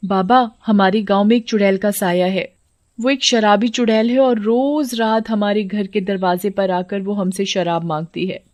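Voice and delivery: usual speaking voice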